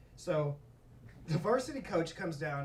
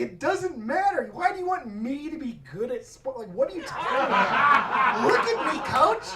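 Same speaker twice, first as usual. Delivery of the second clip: incredulous protest; 5 semitones higher, 4 dB louder than usual. Retold or imitated. Acting his amused protest to coach.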